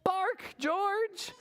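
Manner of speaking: high sing-out call; coaxing mother. Shown as a demonstration. Teacher leading children to call to George, high coaxing. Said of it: "Can you say that?".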